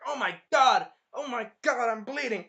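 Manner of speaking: high, loud alarm; hammed-up panic. Hero seeing his own blood, loud mock panic. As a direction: wail it loudly in mock panic.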